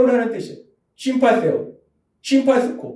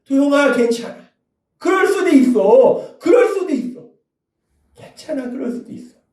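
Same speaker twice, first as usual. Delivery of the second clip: tender comfort; 6 semitones higher than usual. Pretend. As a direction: soothe him, let it die to a breath. Jesus speaking to him comfortingly. (예수님이 도영에게 부드럽게 달래듯 말하는 위로)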